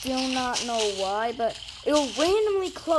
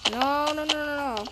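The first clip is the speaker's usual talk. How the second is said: ordinary talk, nothing marked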